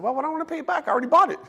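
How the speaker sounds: high, innocent puzzlement; mock-clueless high question; naive question. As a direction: ask it like it makes no sense to pay back. Retelling his clueless reply.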